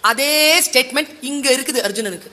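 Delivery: ordinary delivery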